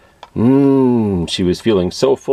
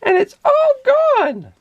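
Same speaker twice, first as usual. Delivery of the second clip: very high, squeaky little bear; baby bear; 4 dB louder than usual. A narrator's reading. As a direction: wail it like a tiny upset cub. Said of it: "he said".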